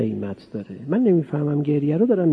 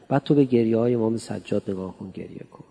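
ordinary talk, nothing marked

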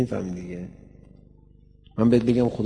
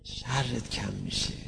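soft, slow repeat; 7 dB quieter, slower than usual. Shown as a demonstration. Preacher repeats key phrase softly. (آرام و کشیده، با صدای پایین)

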